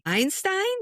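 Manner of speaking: high, rising incredulous question; startled disbelief. Acted. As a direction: blurt it as an astonished question. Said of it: "Now here's me".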